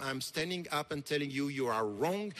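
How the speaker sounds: ordinary talk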